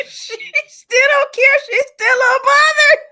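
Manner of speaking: high, loud, drawn out through laughter; cracking up. Real, not acted. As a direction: squeal it out, helpless with laughter. Cracking up while retelling own reaction.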